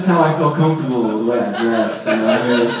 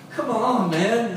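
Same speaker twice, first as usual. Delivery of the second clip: pleading call skyward, higher pitched; mock calling out; 7 semitones higher, 3 dB quieter, much slower than usual. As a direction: call up in protest, half-hearted shout. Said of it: "start shouting up to the sky".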